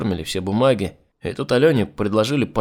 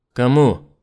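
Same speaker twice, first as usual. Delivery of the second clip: sharp demand; 2 semitones higher, 8 dB louder than usual. Acted. Urgent questioning.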